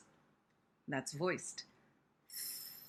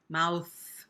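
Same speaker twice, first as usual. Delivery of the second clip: ordinary talk, nothing marked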